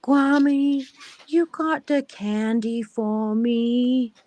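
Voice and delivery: put-on high, slow little-girl ask; toddler voice. Grandma mimics granddaughter asking for candy. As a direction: plead sweetly like a small child. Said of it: "every time she's like".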